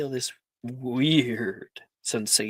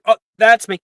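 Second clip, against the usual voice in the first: put-on eager boy's answer; 7 semitones higher, 12 dB louder than usual. Wilfred answers his name.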